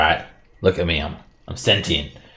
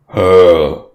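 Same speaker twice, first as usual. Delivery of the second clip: surprised grunt; 10 dB louder than usual. Acted. Eye widens at gift.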